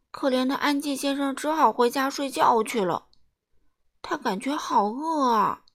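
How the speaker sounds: ordinary delivery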